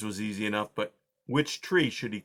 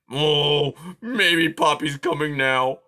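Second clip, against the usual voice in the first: hopeful grumbling porcupine; 4 semitones higher, 6 dB louder, slower than usual. A narrator's reading. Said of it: "he thought".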